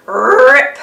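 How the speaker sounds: loud voiced rip; ripping sound. The storyteller voices Arthur's pants tearing, loud rip sound. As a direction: bark out the tearing noise.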